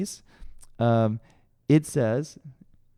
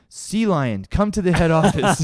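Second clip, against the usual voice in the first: louder, higher, half-laughing loudspeaker call; mock PA announcement; 5 semitones higher, 5 dB louder than usual. The sensei paging him across the arena, mock loudspeaker call. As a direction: boom it out over the arena PA. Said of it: "he gets on the P A and goes".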